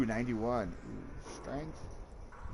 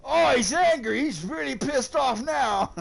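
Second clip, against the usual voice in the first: higher, louder excited Australian wildlife-host voice, growing more thrilled; a hammed-up Australian croc hunter, louder and higher; Steve Irwin impression; 8 semitones higher, 4 dB louder than usual. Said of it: "They're making fun of, uh, Steve Irwin".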